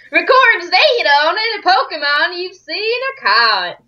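high, hammed-up noble accent; super refined and noble. Professor explains the Pokedex, super refined and noble. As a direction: put on an exaggeratedly refined voice. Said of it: "Super, super refined and noble".

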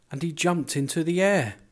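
high, loud burst of storytelling; excited narration. A narrator's reading. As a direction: build to a startled leap. Climax of the story.

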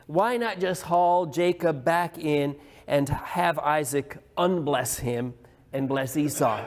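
ordinary talk

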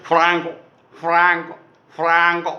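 a lightly voiced frog calling the name; frog croak imitation. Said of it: "Franco എന്ന് വിളിച്ചിട്ടാണ് കരയുന്നത്".

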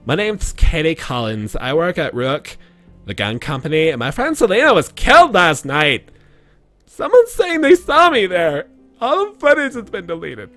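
put-on high California girl voice; valley girl. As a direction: read it as a ditzy California girl. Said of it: "California girl Katie Collins right here".

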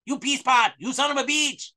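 mocking Delavina screaming at Garrett in a fake accent; mock furious scream. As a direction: ham up a woman screaming curses. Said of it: "screams at him".

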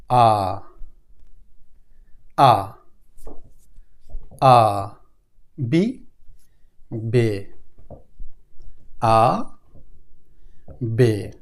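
আ, বে said slowly and low, each letter on its own; German alphabet. Shown as a demonstration. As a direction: read out আ, বে letter by letter. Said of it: "এ থেকে H পর্যন্ত".

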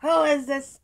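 loud frustrated yell; angry shout. Real, not acted. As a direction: shout at the rain. Angry at the rain in game.

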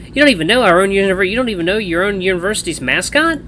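high-pitched, disbelieving question; incredulous. A narrator's reading. Fan astonished at the ignorance.

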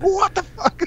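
high squeal imitating mom; mock shocked mom. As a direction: squeak it out like a startled mother. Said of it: "And she was like".

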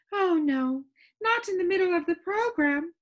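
high, lilting lady's voice; dreamy Soundkeeper. A narrator's reading. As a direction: rhapsodize in a high, airy character voice.

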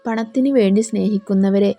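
ordinary delivery, nothing marked

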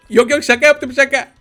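put-on high, hurried childish backpedal; Bebek Gaffur. Baby telling dad it was a joke, high childish voice. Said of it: "Bebek Gaffur olalım".

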